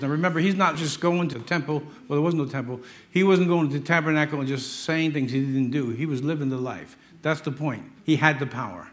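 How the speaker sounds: normal talking voice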